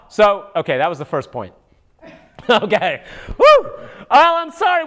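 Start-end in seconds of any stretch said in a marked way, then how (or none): 3.3-3.7 a loud, high whoop. Real, not acted. To the viewers, a loud, high whoop. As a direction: whoop it up wildly.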